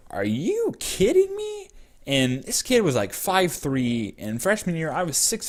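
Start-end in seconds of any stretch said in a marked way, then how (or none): 0.1-1.7 high, drawn-out incredulous question; stretched, pitched-up indignant astonishment; mock disbelief. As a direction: squeak it out in exaggerated disbelief. Retold disbelief at rival's boast.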